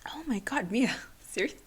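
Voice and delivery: breathy unvoiced self-scolding through tears; tearful whisper to herself. Real, not acted. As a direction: scold yourself softly while crying. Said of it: "and now I'm crying".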